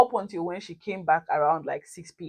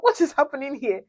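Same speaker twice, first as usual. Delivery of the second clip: bewildered inner voice; 8 semitones higher, 3 dB louder than usual. Retold or imitated. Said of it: "in my mind, I'm like".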